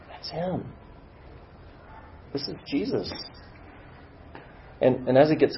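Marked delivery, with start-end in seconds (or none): 0.1-3.1 soft, higher, hushed with wonder; awed hush. Acted. Struggler recognising Jesus coming closer, awed hush. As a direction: breathe it in quiet amazement.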